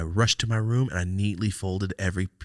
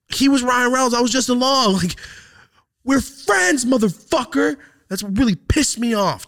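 pitched way up and loud, venting betrayal; furious high rant; 7 dB louder than usual. Real, not acted. Ranting to his podcast co-host about a friend who screwed him over, high and loud. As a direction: let the anger explode, high and loud.

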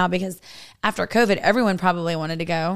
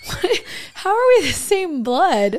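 incredulous; 8 semitones higher, 3 dB louder than usual. Real, not acted. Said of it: "mock disbelief at cousins".

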